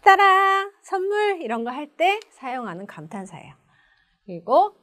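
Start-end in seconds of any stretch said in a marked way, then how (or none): 0.0-1.4 high ta-da, held out, then announcing a gift; surprise reveal. Shown as a demonstration. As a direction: produce a hidden present with a flourish. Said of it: "손에 뒤에 감추고 있다가". (숨긴 선물을 짜잔 내밀며)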